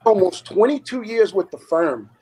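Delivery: high-pitched retold outrage, no full yell; retold scream. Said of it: "And I screamed at the guy".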